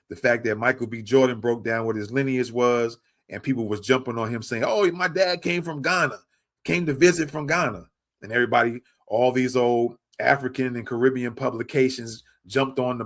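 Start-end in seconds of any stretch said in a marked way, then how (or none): 4.7-7.9 higher-pitched imitation of online critics; put-on, higher quoting voice; mocking quote. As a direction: mimic them in a raised voice. Mockingly quoting people jumping on him.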